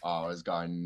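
ordinary talk, nothing marked

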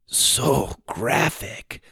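soft slow whisper. A narrator's reading. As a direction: breathe it out slowly, hushed. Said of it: "Sawyer whispered".

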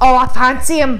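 loud, slow British swooning; hammed-up British crush; mock British accent. Acting British Love Island contestants.

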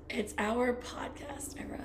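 slow, soft ASMR-style whisper; playful whisper. Pretend. Co-host naming their new podcast era, whispering. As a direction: whisper it slowly like a secret slogan.

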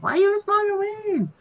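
high-pitched mock yelling. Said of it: "Maybe he was yelling at us".

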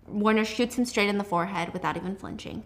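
usual speaking voice

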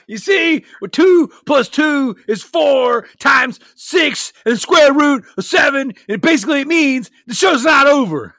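high, loud hammed-up wrestler promo; wrestling promo. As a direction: cut a wild heel promo at the crowd.

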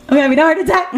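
high, laughing cry of alarm; hammed-up panic, cracking up; mock heart-attack panic. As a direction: play-act alarm through giggles. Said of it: "I even got a pain in my chest".

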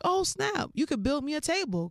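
high-pitched, delighted outburst; acted excited realization. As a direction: pounce on a sudden bright idea. Acting her excited reaction to construction.

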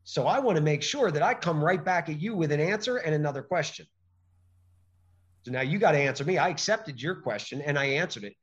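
ordinary talk

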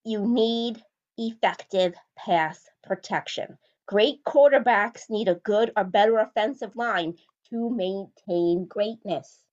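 slow, deliberate delivery, each word spaced out; slow and weighty, like laying down a rule; said slowly. Shown as a demonstration. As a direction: spell it out slowly so nobody misses it. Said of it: "I'm gonna try to say it as slowly as possible".

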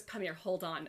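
soft low reassurance. Retold or imitated. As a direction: murmur it low and gentle. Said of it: "He just goes".

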